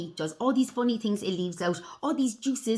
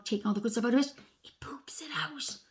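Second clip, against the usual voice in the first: hushed, cheeky whisper; conspiratorial whisper; 4 dB quieter than usual. Shown as a demonstration. Telling children about poop, conspiratorial whisper. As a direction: drop to a whisper as if sharing something rude.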